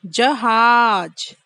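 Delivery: जहाज slowly stretched; slow drill. Shown as a demonstration. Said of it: "तीन अक्षर वाले शब्द".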